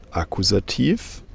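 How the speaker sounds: ordinary talk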